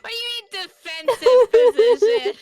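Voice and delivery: high loud indignant squeak; shrill mock outrage of a friend; imitating Eliza. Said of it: "Like hearing Eliza's confident voice".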